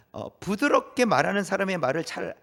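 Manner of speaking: normal talking voice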